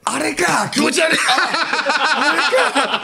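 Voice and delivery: high, laughing disgust. Real, not acted. Grossed out, laughing.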